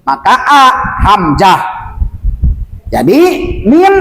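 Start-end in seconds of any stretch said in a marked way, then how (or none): 3.7-3.9 min said clearly and cut off; cut short. Shown as a demonstration. Teacher warns students to cut it, short min. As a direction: stop the n of min without lengthening.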